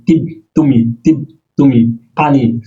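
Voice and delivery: normal talking voice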